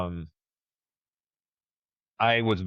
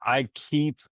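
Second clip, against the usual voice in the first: ordinary talk, nothing marked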